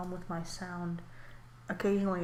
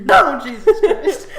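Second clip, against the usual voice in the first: laughing shout; 5 semitones higher, 15 dB louder, slower than usual. Real, not acted. Shrieking while laughing.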